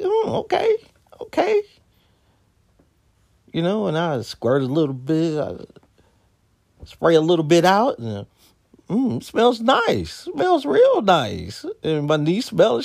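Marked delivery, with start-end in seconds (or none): 0.0-1.6 high, curious oh okay; mock impressed at the label; intrigued oh okay. Reading the label, a high intrigued okay. 8.9-11.6 slow, high, appreciative; savoring a scent. Retold or imitated. Sniffing the perfume at T J Maxx, slow and pleased.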